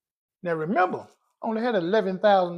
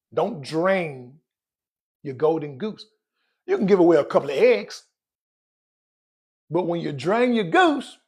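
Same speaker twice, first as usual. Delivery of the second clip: usual speaking voice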